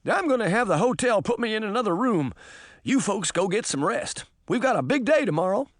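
high-pitched, put-on character voice; high character dialogue; story character voice. A narrator's reading. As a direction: voice a character, pitched well above narration.

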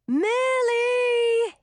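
roared at full voice; roaring. A narrator's reading. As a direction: roar the name, high and loud. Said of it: "he roared".

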